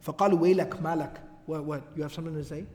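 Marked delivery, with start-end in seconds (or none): 1.5-2.7 low, quiet menace; quiet and threatening, daring him to answer; low warning. Retold or imitated. Ansar stopping Abdullah at the masjid door, low and menacing.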